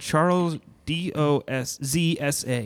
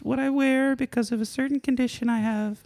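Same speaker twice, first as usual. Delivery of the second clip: much higher put-on character voice; shy masked oddball woman; 12 semitones higher than usual. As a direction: introduce yourself as an awkward, shy guest. Plays a shy masked guest.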